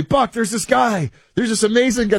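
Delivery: very high-pitched mock excitement; high, hammed-up imitation of pushy well-wishers; eager recommender impression. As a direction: gush like an over-eager fan giving a tip. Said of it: "This is what people tell me".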